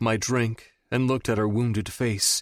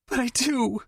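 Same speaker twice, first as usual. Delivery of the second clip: much higher, wailing. A narrator's reading. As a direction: cry it out like a sob. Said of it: "she wailed".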